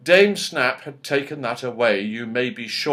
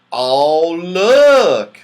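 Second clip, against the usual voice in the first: loud, rising gasp of wonder; astonished; 5 semitones higher, 11 dB louder than usual. A narrator's reading. Moonface sees the key slide under the door, loud and amazed. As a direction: point out the surprise. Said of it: "said Moonface in astonishment".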